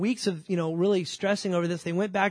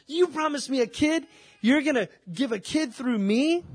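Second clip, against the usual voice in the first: high-pitched, disbelieving questions; acted incredulity; 8 semitones higher than usual. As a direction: play Abraham astonished at God's promise. Acts out Abraham's incredulous reply to God.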